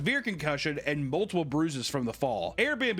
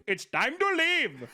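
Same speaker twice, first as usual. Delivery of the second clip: put-on slow, higher failed Dutch accent sliding into Indian; accidental Indian accent; 10 semitones higher, slower than usual. Husband ordering the guests out, in a drifting mock Indian accent. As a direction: try a Dutch accent and land on an Indian one. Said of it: "It's Indian".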